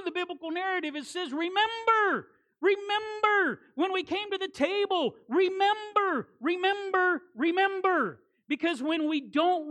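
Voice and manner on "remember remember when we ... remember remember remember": preaching refrain. Acted. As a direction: drive the refrain home.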